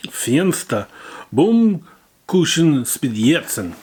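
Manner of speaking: slow, loud mock German; mock German accent. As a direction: pronounce it like a German announcer. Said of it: "what I can only describe as".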